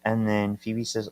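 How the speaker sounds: normal talking voice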